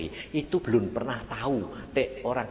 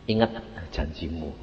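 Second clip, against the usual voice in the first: low, soft solemn reminder; solemn God voice; 10 semitones lower, 5 dB quieter than usual. Retold or imitated. God reminding the preacher of his vow, low and grave. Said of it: "Tuhan berkata begini".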